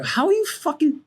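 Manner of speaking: high-pitched mock outburst; exasperated disbelief. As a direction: blurt it high, imitating your own bafflement. Retelling his frustrated reaction while gaming.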